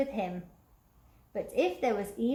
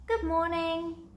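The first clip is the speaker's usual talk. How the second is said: smiling greeting; 7 semitones higher than usual. A narrator's reading. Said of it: "Elmer smiled and said".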